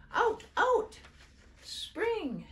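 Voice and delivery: put-on very high, loud toddler shout. A narrator's reading. The baby shouting to her brother and sister, loud and gleeful. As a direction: yell it with baby glee.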